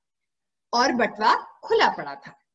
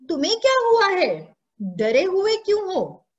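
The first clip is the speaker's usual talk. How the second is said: high-pitched, probing questions; lightly acted aunt, voice lifted in concern; concerned questioning; 7 semitones higher, 3 dB louder than usual. Aunt asking the boy why he looks scared, concerned questioning.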